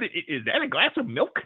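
laughing through a mimicked copy of the question; the waiter mocking how the guest said it, through laughter; mocking imitation, laughing. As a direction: parrot the question back while cracking up. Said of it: "start laughing and go".

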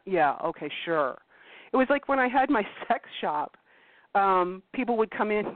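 ordinary delivery

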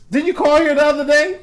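high-pitched accusatory question; accusing mom. Retold or imitated. Mom confronting a telemarketer with an accent, accusing. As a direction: imitate a mom confronting a caller. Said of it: "My mom's approach now is".

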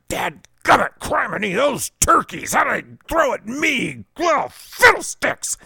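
high, hammed-up huffy mock cursing; an angry dad in a car chase, mock muttering; dad cursing under breath. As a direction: sputter tame curses like a flustered dad. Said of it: "I just hear him under his breath".

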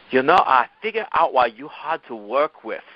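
a hint of a Korean boss's accent; Damiana's Korean accent. Korean boss telling Milo why he's hard to work with, mild Korean accent. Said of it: "impersonate her without doing her accent".

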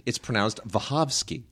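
WACHOWSKI said "va-HOV-skee"; Polish pronunciation. Retold or imitated. Host quoting his Polish friend's correction, Wachowski as va-HOV-skee. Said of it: "He says".

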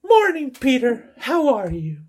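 high, drawn-out put-on female voice; woman's voice. Cindy greets Peter in the dialogue, high put-on woman's voice. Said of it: "la voz de mujer".

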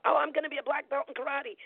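put-on high, eager child voice; excited kid. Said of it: "I wanted to".

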